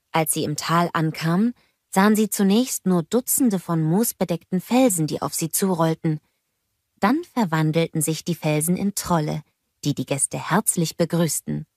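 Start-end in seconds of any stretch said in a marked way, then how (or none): none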